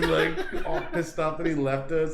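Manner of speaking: normal talking voice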